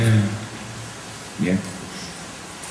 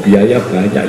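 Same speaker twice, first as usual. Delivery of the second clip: usual speaking voice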